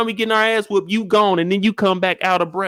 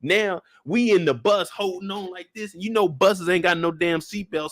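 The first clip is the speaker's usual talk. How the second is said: ordinary talk, nothing marked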